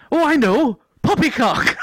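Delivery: put-on high, lilting Welsh accent with laughter breaking in. Quoting a mate's code phrase, Welsh accent. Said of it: "I said poppycock in a Welsh accent".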